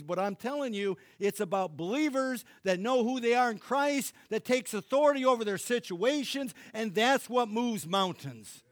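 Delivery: building, then loud and rising, preaching hard; preaching at full force. Acted. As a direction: drive it home like a revival preacher.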